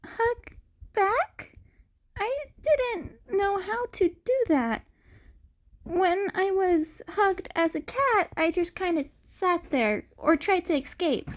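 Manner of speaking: put-on high, naive boy voice; puzzled cat boy. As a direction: wonder at it, innocently.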